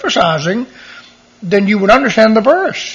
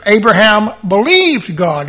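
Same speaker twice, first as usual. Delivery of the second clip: "believed" pitched up and drawn out; stress on believed; 3 semitones higher than usual. Shown as a demonstration. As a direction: lean hard on "believed". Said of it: "There's another emphasis word right there".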